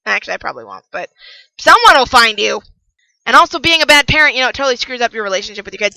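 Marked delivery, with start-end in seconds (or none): none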